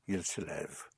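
a hushed model repetition for the student; whispered. Shown as a demonstration. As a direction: whisper the phrase softly. Teacher repeats French phrase softly.

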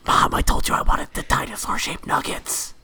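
whispered tantrum; ASMR gamer. Pretend. Host as gamer complains to mom about nuggets, whispered. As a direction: whine at mom entirely in a whisper. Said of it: "gamer-themed A S M R".

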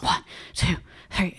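whispered, breathless mock CPR counting. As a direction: count like you're out of breath from compressions. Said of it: "he's just out of breath because he's frantic".

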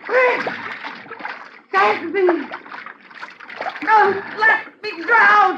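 terrified pleading. Acted. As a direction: beg for your life, screaming. Wife being drowned, pleading.

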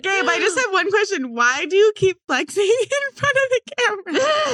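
high laughing question; giggling through the words. Real, not acted.

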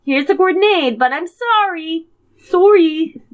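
put-on high apologetic Canadian accent; overly apologetic Canadian voice. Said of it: "I said it funny".

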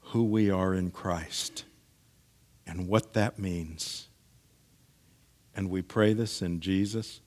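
soft, low prayer voice; closing prayer. Real, not acted. Pastor closing a prayer in Jesus' name, hushed and reverent. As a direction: pray quietly, with pauses between phrases.

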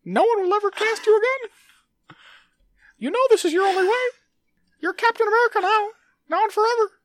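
loud, very high squeaky mouse; a greedy cartoon mouse, squeaking loudly; Mickey Mouse voice. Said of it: "Mickey Mouse Voice".

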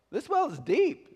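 high, slow southern drawl; sweet southern accent. Acted. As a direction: draw it out like a sweet southern lady. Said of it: "She's responding in her southern accent".

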